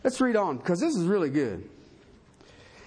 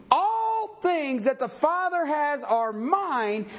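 slow scripture reading; 8 semitones higher, slower than usual. Acted. Reads scripture slowly.

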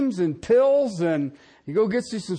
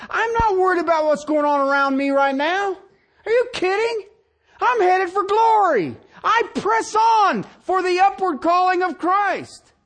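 high, loud exultant Paul; triumphant apostle crying out; 9 semitones higher, 4 dB louder than usual. Acted. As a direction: shout it like Paul headed for glory. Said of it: "he looked at it and he says".

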